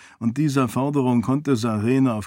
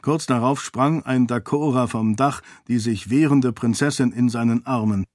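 plain reading voice

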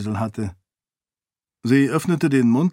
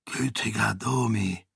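softer, shaken king; whispered shock; 6 dB quieter than usual. A narrator's reading. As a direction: breathe it out in dismay. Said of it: "flüsterte Eventeo". (leises, erschrockenes Flüstern)

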